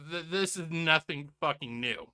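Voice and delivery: ordinary talk, nothing marked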